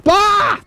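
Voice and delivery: sudden high yelp; short, high cry of alarm; startled yelp. Real, not acted. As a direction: yelp as if caught off guard mid-sentence. Startled mid-remark while near bees.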